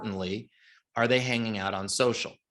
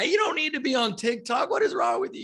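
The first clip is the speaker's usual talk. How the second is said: much higher mock yell, incredulous; crowd yelling. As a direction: scold him like a whole exasperated room. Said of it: "yelling at the guy".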